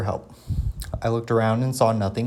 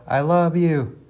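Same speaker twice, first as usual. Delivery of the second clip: echo reply; 6 semitones higher, 3 dB louder, slower than usual. A narrator's reading. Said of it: "came the reply".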